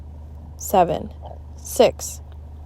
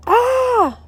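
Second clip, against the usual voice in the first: startled scream; 5 dB louder than usual. A narrator's reading. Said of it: "Miss Banks swung around and screamed".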